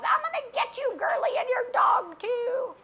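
screech-and-cackle storybook witch voice; Wicked Witch impression. Preacher mimicking the Wizard of Oz witch, high witch voice. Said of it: "like the Wizard of Oz".

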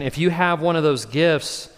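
ordinary talk, nothing marked

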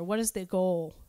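ordinary delivery

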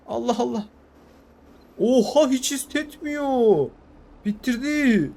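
high, loud, wide-eyed amazement; gasping with excited wonder; amazed excitement. Real, not acted. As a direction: cheer the tractor on in disbelief. Amazed at fast tractor.

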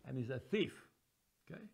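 ordinary delivery

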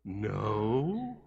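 put-on small, hushed child's hello; a timid kid picking up the phone, hushed; quiet little boy. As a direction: answer softly like a shy little boy. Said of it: "real quiet and soft".

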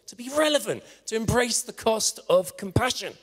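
rousing, emphatic sermon appeal; impassioned preaching. Real, not acted. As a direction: preach it with rising urgency.

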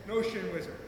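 ordinary delivery

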